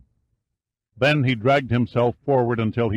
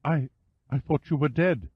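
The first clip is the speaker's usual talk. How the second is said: higher woman's voice, halting and shaken; stammered softly; 6 semitones higher than usual. A narrator's reading. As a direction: stumble over the first word, still shaken. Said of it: "She stammered the words softly".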